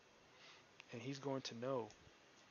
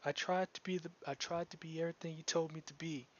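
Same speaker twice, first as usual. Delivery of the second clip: put-on young girl; 5 semitones higher, 12 dB louder than usual. NPC girl confesses past.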